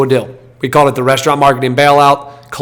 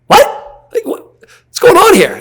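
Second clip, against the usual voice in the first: high, stunned What? with pitch flying up; stunned disbelief; 3 dB louder, slower than usual. Retold or imitated. Retelling the bank's call, thrown by a boat question and asking what's going on. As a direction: sound floored by the question. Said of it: "And we're like".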